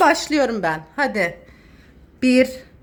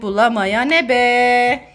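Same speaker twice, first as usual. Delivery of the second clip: drawn out; 4 semitones lower, much slower than usual. Real, not acted. Drawn-out rhyme ending.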